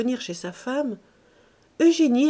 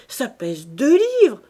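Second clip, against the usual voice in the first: a miser gloats loudly; gloating over gold; 3 semitones higher, 6 dB louder than usual. Acted. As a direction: weigh every word like gold.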